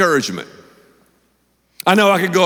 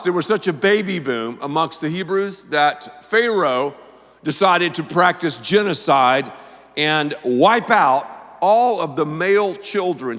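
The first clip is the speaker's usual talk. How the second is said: normal talking voice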